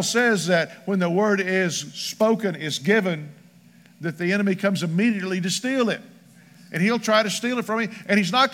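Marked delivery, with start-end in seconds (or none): none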